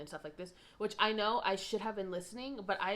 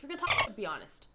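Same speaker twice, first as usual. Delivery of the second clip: angry burst; 7 semitones higher, 7 dB louder, faster than usual. Retold or imitated. Roommate quarrel, calling out Tammy.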